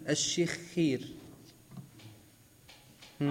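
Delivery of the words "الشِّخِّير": الشخير slowly as ash-shikh-kheer; doubled kha with kasra. Shown as a demonstration. Teacher repeats name after explaining, slow careful pronunciation. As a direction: draw out the doubled kh in الشخير, then long ee. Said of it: "وتشديد الخاء وكسرها".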